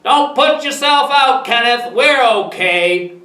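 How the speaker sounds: loud, high call, slowing at the end; shouting back. A narrator's reading. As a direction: holler a reply across the lawn. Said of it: "Grampy shouted back".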